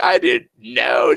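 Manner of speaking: put-on high, slow protesting kid; whiny daughter. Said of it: "And she's like".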